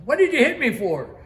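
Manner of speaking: high, loud accusing question; indignant retold outburst. Injured crewman accuses shipmate of hitting him, loud indignant retelling. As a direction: demand an explanation at full voice, re-enacted.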